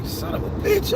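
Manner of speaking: a half-voiced, exasperated son of a bitch; breathy disbelief. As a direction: let it out as a stunned hiss. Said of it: "I say".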